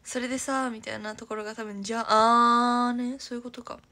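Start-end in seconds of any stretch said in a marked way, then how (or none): 1.8-3.2 slow, loud じゃあね; emphatic drawn じゃあね. Shown as a demonstration. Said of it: "demoing Nagoya じゃあ usage".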